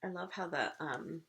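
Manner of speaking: hushed awe. Real, not acted. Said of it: "beautiful two-page illustration".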